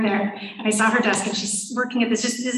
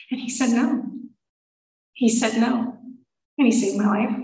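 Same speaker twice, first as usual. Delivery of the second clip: ordinary talk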